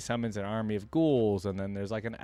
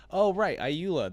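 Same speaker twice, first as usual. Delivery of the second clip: sudden realization; 7 semitones higher, 5 dB louder, slower than usual. Real, not acted. Suddenly recalls who Iula is.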